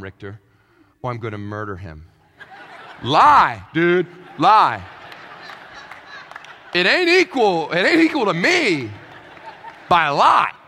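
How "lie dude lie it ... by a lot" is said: loud punchy answer, each word hammered; emphatic shout. Acted. Telling the kids to lie, shouted loud.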